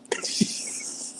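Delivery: unvoiced-sounding wheeze of laughter; laughing. Real, not acted. Cracking up after rehearsing the call, real laugh. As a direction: crack up at your own bit.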